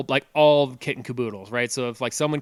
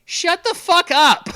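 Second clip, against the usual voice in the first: much higher, louder, slow mock yell; neighbor yelling back; 8 dB louder, slower than usual. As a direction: bark it back from a window. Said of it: "someone's, like, outside their window".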